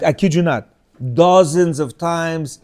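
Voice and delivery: ordinary talk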